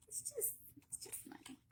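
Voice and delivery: high whispered throwaway; mock-casual whispered shrug; breathy aside. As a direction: toss it off in a whisper. Joking aside about cost.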